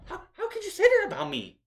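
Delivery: high, fast, pleading mock wail; hammed-up hurt manager begging; passionate whiny Heyman. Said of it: "He's like, ... getting very passionate".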